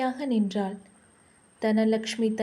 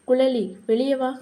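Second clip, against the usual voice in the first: a mildly raised call; 4 dB louder than usual. A narrator's reading. Woman calls her younger sister to come outside, mildly calling out. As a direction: call her out, a touch louder. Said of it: "எதுக்காக நீ கத்திட்டு இருக்க".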